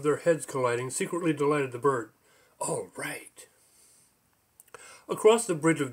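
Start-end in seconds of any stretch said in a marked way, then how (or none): 2.6-3.5 unvoiced breathy whisper. A character whispering to himself, whispered. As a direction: breathe it out with no voice, secretly. Quiet line in the story.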